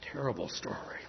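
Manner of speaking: hushed whisper; whispered aside. Real, not acted. Speaker reacting to the sad story, whispered. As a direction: whisper it to yourself.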